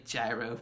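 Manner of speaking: gyro said as JAI-ro, with a J; wrong pronunciation. Shown as a demonstration. Vlogger begging travelers not to say it, gyro mispronounced JAI-ro. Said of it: "just don't pron-, don't say".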